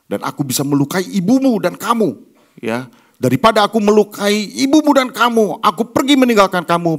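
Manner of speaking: father defends leaving his family, in a loud emotional outburst. Retold or imitated. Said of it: "dalam keadaan emosi".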